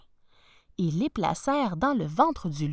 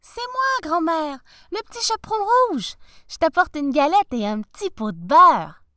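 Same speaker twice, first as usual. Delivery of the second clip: put-on little girl voice; 8 semitones higher, 9 dB louder than usual. Girl answers at the door.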